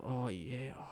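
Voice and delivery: soft mock moaning under the breath; moaning to himself. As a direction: imitate a quiet lecherous moan. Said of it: "he'd start moaning to himself".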